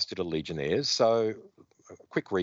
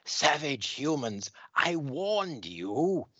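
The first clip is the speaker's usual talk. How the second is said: slow, menacing alien villain, pitched higher; 7 semitones higher, slower than usual. Acted. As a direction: drag it out like a cold alien conqueror. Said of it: "the Dominator is going".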